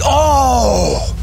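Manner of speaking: sharp high startled oh; surprised oh. Real, not acted. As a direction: caught off guard as the line goes. Line snaps on a big fish.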